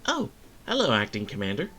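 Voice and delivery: high cheerful nurse. Acted. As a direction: play a sweet, chirpy nurse. Voices a cheerful nurse character.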